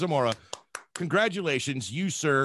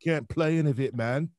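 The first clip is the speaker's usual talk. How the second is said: badly attempted Australian accent, mocking a friend; hammed-up Australian accent; 2 semitones higher, faster than usual. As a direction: do a poor Australian impression. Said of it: "my worst attempt at Australian".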